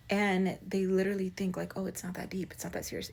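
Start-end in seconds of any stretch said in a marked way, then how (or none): 1.8-3.1 very soft, imitated brush-off; mock dismissive. Mimicking people who think it's no big deal, dismissive. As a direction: drop the volume right down, mimicking their dismissal.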